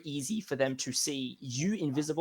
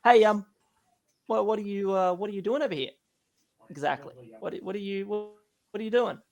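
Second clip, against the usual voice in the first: lightly voiced guard; 5 semitones higher, faster than usual. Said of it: "He's wandering over and say".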